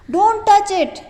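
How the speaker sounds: high, loud shout of warning. Shown as a demonstration. Teacher reading the boy's warning, shouting. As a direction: yell it to stop him. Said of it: "shouted the boy".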